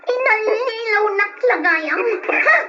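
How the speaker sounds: high, loud outraged yelling; furious shout. Acted. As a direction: explode at him, betrayed. Wife's mistress confronts cheating husband.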